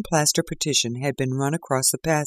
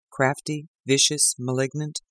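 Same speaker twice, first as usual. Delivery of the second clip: ordinary delivery, nothing marked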